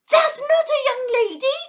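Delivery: very high, loud squeal; shriek. A narrator's reading. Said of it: "shrieked Plop".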